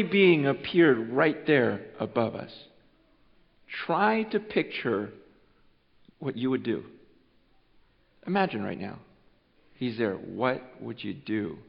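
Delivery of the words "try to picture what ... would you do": soft, low, slowed-down invitation; hushed, intimate preacher tone. Acted. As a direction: draw them in quietly.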